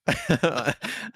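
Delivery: a real high burst of laughter; laughing. Real, not acted. Laughing at the retold exchange.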